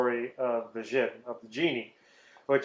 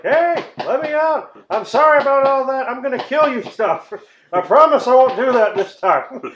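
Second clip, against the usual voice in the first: high, loud, comic begging; hammed-up panicky bargaining; pleading trapped genie; 11 semitones higher, 8 dB louder than usual. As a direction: call out desperately from inside a bottle.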